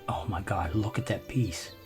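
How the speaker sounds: usual speaking voice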